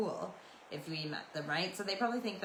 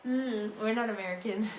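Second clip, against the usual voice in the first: higher-pitched mock-bratty comeback; childish retort; 4 semitones higher than usual. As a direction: re-enact a petulant little comeback. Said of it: "added to the thing, turning around and saying".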